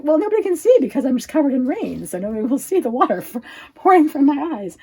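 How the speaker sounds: self-pity quote. Retold or imitated. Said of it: "I'm crying".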